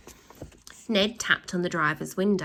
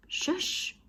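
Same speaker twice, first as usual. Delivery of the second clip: a high, unvoiced, softer shush; 11 semitones higher, 6 dB quieter than usual. A narrator's reading. Ned hushes Slime in a soft, breathy shush. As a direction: hush him with a quick shh. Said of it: "shushed Ned".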